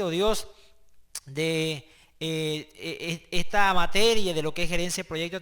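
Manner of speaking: fervent spoken prayer to the Father, rising on each plea; opening prayer. Acted. Teacher praying over his students before the lesson, fervent prayer. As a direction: pray earnestly over the class.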